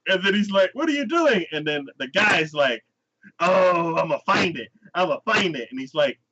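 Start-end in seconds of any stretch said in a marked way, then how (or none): 0.7-1.5 high, startled question; high-pitched protest; mock bewilderment. Confused opponent, acted. 3.4-5.7 higher, playful teasing promise; put-on eager hero voice; eager wrestler. Wrestler hunts scratch spot.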